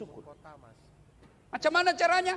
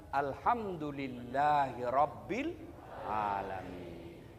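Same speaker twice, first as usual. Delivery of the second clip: slow, low, reverent recitation; devout recitation; 12 semitones lower, 5 dB quieter, much slower than usual. Shown as a demonstration. Preacher shows the congregation how to give thanks, slow and reverent. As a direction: intone it slowly and low.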